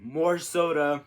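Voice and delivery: retold yell. Customer yells at servers across the room, retold mock yell. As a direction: imitate a customer calling out, slowly. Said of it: "yell at us across the room".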